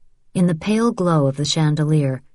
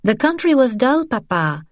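put-on young woman voice; 7 semitones higher than usual. Isabelle voiced.

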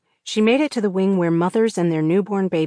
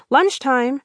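higher sing-song lilt; 7 semitones higher, 3 dB louder than usual. Acted. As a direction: chirp it brightly, sing-song. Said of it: "in what she hoped was a pleasant sing-song voice".

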